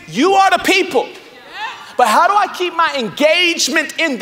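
loud, high preaching, pushing each phrase out; preaching at full force. Acted. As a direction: preach it to the back row.

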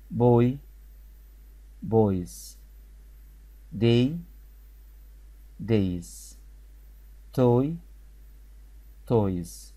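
slow word drill with a pause after each word; teacher drilling singular and plural words; repeat-after-me drill. Shown as a demonstration. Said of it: "نقولهم مع بعض كلمات".

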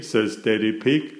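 ordinary delivery

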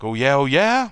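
high, loud mock challenge rising at the end; provoked comeback, pitched well up; Michael, challenged. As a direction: imitate a star player taking up a dare. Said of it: "Michael says like that".

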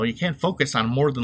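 ordinary talk

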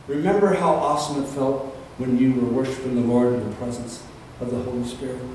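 ordinary talk

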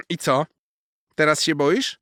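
high, loud mocking squirrel question; teasing squirrel. A narrator's reading. Squirrel teasing the stuck cat, high and loud. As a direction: needle him playfully.

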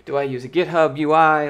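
ordinary talk, nothing marked

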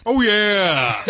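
Kool-Aid Man. Acted. Podcaster breaks up the robot bit, Kool-Aid Man voice. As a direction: explode into the mascot's catchphrase, laughing after. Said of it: "twenty percent Kool-Aid, man".

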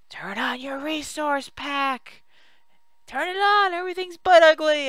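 high, strained mock yell; yelling viewers. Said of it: "you guys are probably yelling at me".

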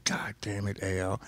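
low, soft muttered curse. Retold or imitated. Screw muttering to Al in disbelief. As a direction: grumble it under the breath. Screw reacts quietly in retold story.

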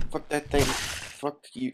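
ordinary talk

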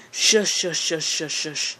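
ordinary talk, nothing marked

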